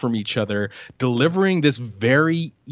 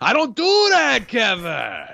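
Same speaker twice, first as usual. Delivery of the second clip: Pacino shout; 8 semitones higher than usual. Retold or imitated. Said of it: "in the Devil's Advocate".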